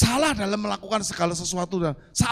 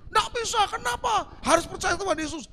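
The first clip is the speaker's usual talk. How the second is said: mock refusal; 10 semitones higher than usual. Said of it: "Kalau orang dunia kan begitu".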